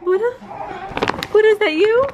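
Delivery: high loud surprised calling; excited surprise. Acted. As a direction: gasp out the name in delight.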